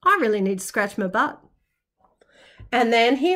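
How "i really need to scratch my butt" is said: low, fast, soft grumble; an itchy wombat grumbling under his breath; grumpy wombat mutter. Acted. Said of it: "he said".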